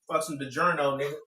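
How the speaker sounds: normal talking voice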